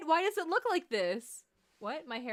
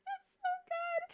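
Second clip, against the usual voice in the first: overcome crying. Real, not acted. Overwhelmed by cute scene.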